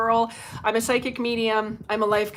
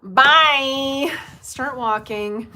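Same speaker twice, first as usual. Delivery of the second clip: dismissive; 4 semitones higher, 7 dB louder, much slower than usual. Real, not acted. Dismissing troll mockingly.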